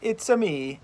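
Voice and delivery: put-on a hammy Mario-style Italian accent; mock Italian accent. Mocking how Americans fake Italian, in cartoon Italian accent. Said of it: "you can't just go".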